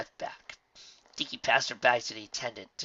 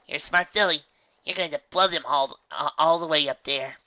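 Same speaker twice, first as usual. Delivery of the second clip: higher, louder encouraging mom voice; proud mother; 4 semitones higher, 8 dB louder than usual. A narrator's reading. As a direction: cheer her on proudly.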